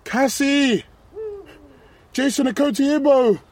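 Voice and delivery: high, loud calling. Acted. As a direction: shout names into the night. Calling lost friends in dark.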